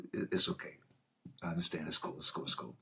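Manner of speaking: hushed, soothing, barely voiced; calm him down gently, almost whispering; soothing Luc Besson. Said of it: "He goes".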